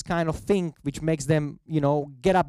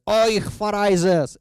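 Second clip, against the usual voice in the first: slow, loud, high mock-German cry of Euch Pharisees; mock German accent, outraged priest; mock German outburst; 6 semitones higher, 5 dB louder, slower than usual. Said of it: "I don't speak German, but something like that".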